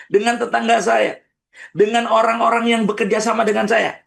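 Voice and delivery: high, urgent listing of grievances; fervent emotional outpouring; impassioned listing. Shown as a demonstration. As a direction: pour out every hurt in one rush. Voices listener naming hurts.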